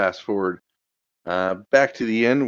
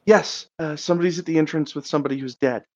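high, fast and alarmed; panicked, breathless; 9 semitones higher, faster than usual. Acted. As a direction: blurt it out in a rush of panic. Panicked townsperson bursting into inn.

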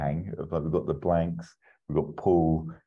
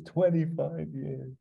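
higher, slow echo of the line; slow wistful echo; 11 semitones higher, slower than usual. Retold or imitated. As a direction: stretch it out, higher and slower. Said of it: "repeated in different voice".

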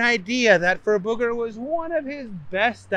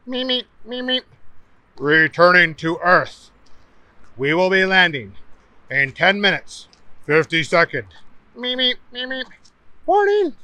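calm ship computer; 3 semitones higher, 4 dB louder than usual. Acted. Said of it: "ship warning over intercom".

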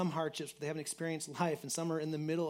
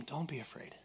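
whispered; 8 dB quieter than usual. Shown as a demonstration. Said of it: "Because God tells him".

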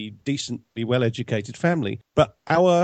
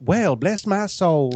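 cartoon Southern belle drawl, higher and slow; Penelope Pitstop; 5 semitones higher, slower than usual. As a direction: draw it out in a hammy Southern accent. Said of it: "trying to do that Penelope Pitstop".

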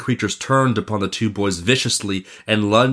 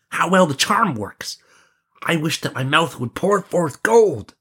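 put-on higher-pitched young prince, wishing aloud; eager prince; 8 semitones higher than usual. Prince testing the charm, high and eager. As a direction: lift the voice into a storybook prince.

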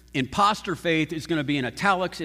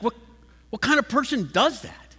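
incredulous; 6 semitones higher than usual. Retold or imitated. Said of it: "James is like".

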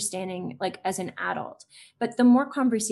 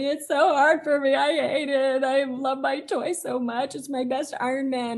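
imitating a sobbing child; 4 semitones higher than usual. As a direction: play the upset little boy with the stolen toy. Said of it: "You know, he's sobbing".

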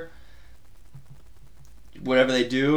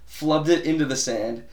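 usual speaking voice

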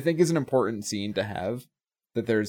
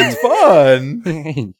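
loud, higher joking exclamation; mock exuberant shout; 5 semitones higher, 10 dB louder than usual. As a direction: belt it out in mock delight. Jokingly echoing co-host's remark.

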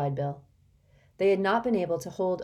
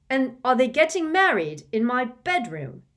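loud, high furious retort; 8 semitones higher, 8 dB louder than usual. Acted. Ron furiously retorts to his mother, loud. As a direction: hurl it at her furiously. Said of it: "asked Ron furiously".

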